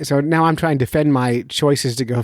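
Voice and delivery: ordinary delivery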